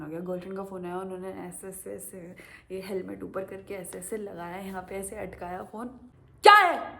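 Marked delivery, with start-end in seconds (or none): 6.4-7.0 sharp, much higher, much louder "क्या है?"; angry bark. Retold or imitated. Driver barking at his girlfriend on the phone, furious.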